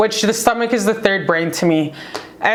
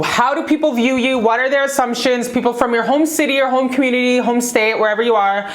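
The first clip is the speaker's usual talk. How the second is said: ordinary talk, nothing marked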